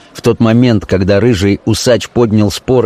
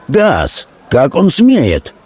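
high, drawn-out indignation; heated exclamation; 6 semitones higher, much slower than usual. A narrator's reading. Hot-tempered notary about the officer, indignant exclamation. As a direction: exclaim it hotly, pitched up. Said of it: "воскликнул горячий нотариус".

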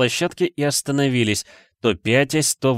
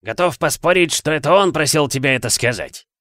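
jeering, raised-pitch exclamation; exclaiming; 5 semitones higher than usual. A narrator's reading. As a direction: scoff aloud for everyone to hear. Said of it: "воскликнула голова сэра Патрика". (насмешливое восклицание)

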